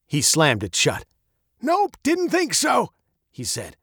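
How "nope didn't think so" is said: put-on high, gravelly old man's voice. A narrator's reading. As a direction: muse like a muddled old man, high and gravelly. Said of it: "his voice as old and gravelly as his face".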